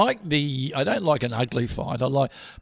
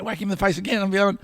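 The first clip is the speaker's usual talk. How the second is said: ordinary talk, nothing marked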